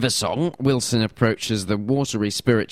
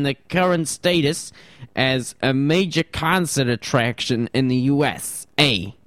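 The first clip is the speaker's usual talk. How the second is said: New York accent; 5 semitones higher than usual. Shown as a demonstration. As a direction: read it like a New York newsman. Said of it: "Need to work on my New York accent".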